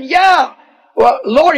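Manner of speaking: high, doubtful yeah and well Lord; incredulous Peter. Acted. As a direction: doubt the Lord's odd command. Peter doubts Jesus' command.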